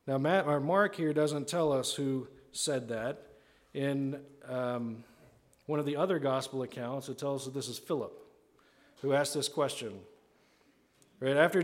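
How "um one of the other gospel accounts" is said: usual speaking voice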